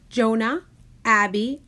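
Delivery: ordinary delivery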